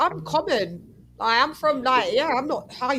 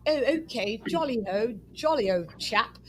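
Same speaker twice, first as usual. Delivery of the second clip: mock posh accent; 5 dB quieter than usual. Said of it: "you're trying to make it like he's like".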